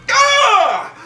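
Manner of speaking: high, loud yelp of fright; a mock startled shriek; startled scream. Jumped by a looming hologram, startled scream.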